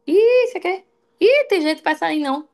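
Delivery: high, loud scoffing "ih"; scornful mock crowd. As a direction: sneer at her like a gossip. Voicing scornful crowd members. (desdém zombeteiro e agudo)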